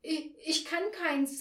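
high, halting confession; flustered admission. A narrator's reading. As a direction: stumble timidly on the first word. Flustered Frau Schilling fails the test.

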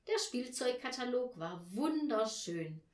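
ordinary delivery, nothing marked

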